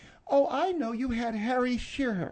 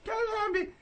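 high, soft attempt at his voice; mock him in a squeaky voice, then give up; failed mimicry; 11 semitones higher, 3 dB quieter, faster than usual. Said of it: "can't even do your voice".

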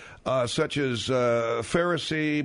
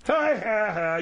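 mock laugh; 6 semitones higher, slower than usual. Mocking laugh at Mexico.

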